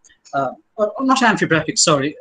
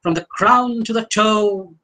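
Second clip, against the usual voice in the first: "toe" held long on a raised, level pitch; dragged open vowel; 4 semitones higher than usual. Shown as a demonstration. Lecturer showing how open vowels lengthen the line, "toe" dragged. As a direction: stretch "crown" and let "toe" drag. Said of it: "may be dragged for a while".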